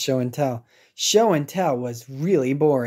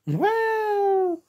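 high held well; sing-song well; drawn-out well. Acted. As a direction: stretch it up high and long. Dramatic pause.

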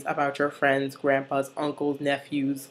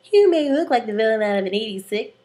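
high, loud mock confrontation; Santana; 5 semitones higher, 5 dB louder than usual. Santana confronting Sebastian, high loud acted challenge. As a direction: go at him higher and louder, play-acting. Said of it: "And then Santana's like".

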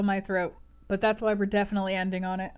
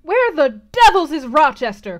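loud, raised colonel's call; indignant cry; 7 semitones higher, 12 dB louder than usual. Acted. Colonel hunts for his host, loud crying demand. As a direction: demand it loudly over a crowd. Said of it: "cried Colonel Dent".

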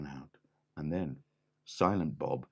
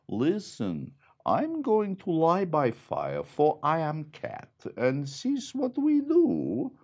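higher, haughty cat voice; 8 semitones higher, 4 dB louder than usual. A narrator's reading. Cat claiming the fire, in a higher haughty voice. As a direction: speak like a proud cat. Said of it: "The cat said".

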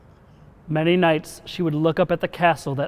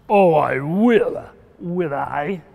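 slow, mocking dragon voice; drawn-out villainous retort; taunting dragon; 4 dB louder, much slower than usual. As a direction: sneer back like an amused monster.

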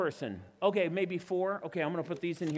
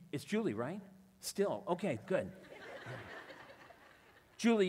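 usual speaking voice

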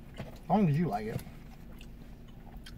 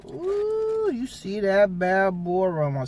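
excited; 6 semitones higher, slower than usual. Real, not acted. Smelling the pizza aroma.

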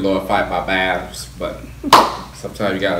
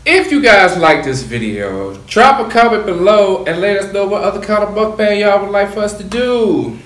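upbeat host sign-off; bright call to subscribers; vlog outro; 9 semitones higher, 6 dB louder than usual. Acted.

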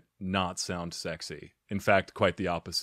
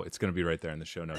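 ordinary talk, nothing marked

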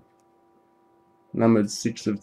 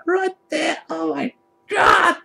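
much higher, shouted cry of joy; ecstatic cry; slower than usual. Real, not acted. Yelling to viewers over a huge pull, ecstatic shout. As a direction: scream it like you can't believe the hit. Big hit on camera.

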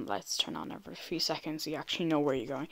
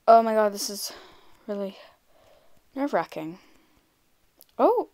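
ordinary talk, nothing marked

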